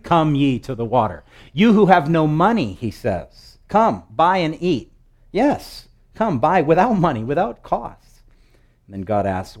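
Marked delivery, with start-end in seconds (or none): 0.0-2.7 loud, raised scripture invitation; calling out. Acted. Acting out God's open invitation. 3.7-8.2 loud, raised scripture invitation; calling out. Acted. Acting out God's open invitation.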